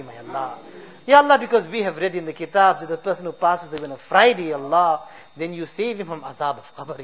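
soft, low pleading prayer with fading endings; preacher closing in humble prayer; heartfelt dua. Acted. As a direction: beg for mercy, voice sinking on each Ya Allah. Closing supplication to God.

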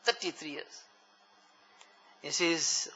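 usual speaking voice

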